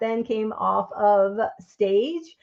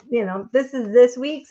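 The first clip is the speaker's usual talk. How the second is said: ordinary talk, nothing marked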